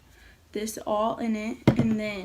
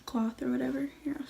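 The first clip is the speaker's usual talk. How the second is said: hushed whisper so he won't hear. Real, not acted. Hiding, setting up the prank.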